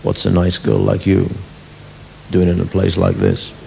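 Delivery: mock-smooth lounge lizard line; deep romantic voice. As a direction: play the smooth chat-up man. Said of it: "deep romantic voice".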